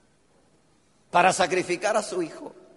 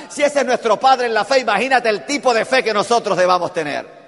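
loud, building sermon delivery; rising preaching; 5 dB louder than usual. Acted. Preacher stirring the congregation about faith, loud. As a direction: build the faith point to a peak.

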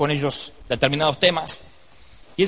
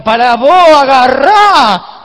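long, high, very loud comic yell; hammed-up shout; 8 semitones higher, 14 dB louder, much slower than usual. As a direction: holler it as if tossing someone a catch. Shouts as matchmakers grabbing any suitor. (grito cómico, agudo y alargado)

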